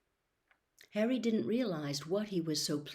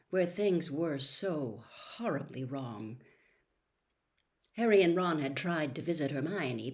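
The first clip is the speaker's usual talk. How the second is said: plain reading voice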